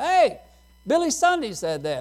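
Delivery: high, loud cry of hey; shouted hey. Retold or imitated. Bible student shouting at the preacher, a high loud hey. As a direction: call out to catch the preacher's ear. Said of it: "and he went".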